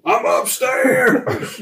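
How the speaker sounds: high, slow, drawn-out yell; yelling across the room. Retold or imitated. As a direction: stretch it out as a lazy shout. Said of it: "That's what I tell people".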